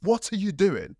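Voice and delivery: a sharp yell from the coach; coach snapping. Retold or imitated. Coach catches him lying down, sharp yell. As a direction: coach barks at the lazy trainee. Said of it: "The coach walked in and was just like".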